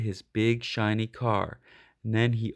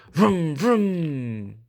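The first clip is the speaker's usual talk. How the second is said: revving engine noise, louder; engine vroom; 4 semitones higher, 4 dB louder than usual. A narrator's reading. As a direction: rev it like a car engine. Engine sound imitated.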